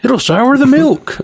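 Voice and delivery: high, slow mock warning; quoting the farmer. Replaying the farmer's line for his cohost, high and slow. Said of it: "it's just really funny the way he says it".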